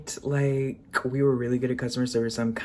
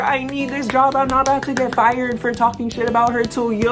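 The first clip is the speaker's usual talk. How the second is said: high, loud put-on voice; mimicking coworker; 9 semitones higher, 6 dB louder than usual. Coworker refusing to gossip, mock high whiny voice. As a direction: whine it like a scared coworker. Quoting coworker's fearful excuse.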